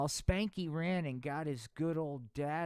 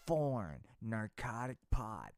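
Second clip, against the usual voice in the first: a lightly voiced stern lawman, lower and softer; mock stern government sermon; J. Edgar Hoover; 5 semitones lower, 5 dB quieter than usual. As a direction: lecture like a sour anti-drug official. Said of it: "said J. Edgar Hoover".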